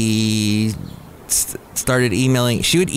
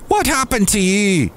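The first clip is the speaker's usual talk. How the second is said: high-pitched, shaky attempt at Irish; Irish bartender, badly attempted; attempted Irish accent; 10 semitones higher than usual. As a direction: try an Irish brogue, pitched up. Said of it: "I can't do an Irish accent right".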